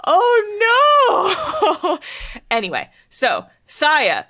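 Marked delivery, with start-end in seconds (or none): 0.1-2.0 very high, comic cry of dismay; mock-horrified wail. Real, not acted. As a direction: wail it out in hammed-up despair. Mock horror at remembered death.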